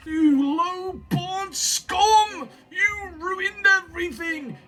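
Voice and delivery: high, very slow, drawn-out rage; enraged grandfather. Acted. Grandfather curses grandchild before collapsing, high, enraged and slow. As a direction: stretch each insult out in high-pitched fury.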